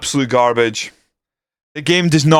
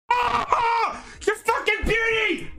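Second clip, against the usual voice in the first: very high, softer laughing yelp; laughing cry of joy; 8 dB quieter, slower than usual. Real, not acted. Fan laughing at his striker's winner, high joyful squeal. Celebrating the goal.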